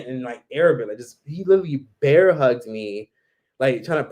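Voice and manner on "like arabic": usual speaking voice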